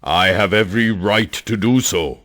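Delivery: stern scolding. Acted. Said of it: "Stop shouting and scolding me".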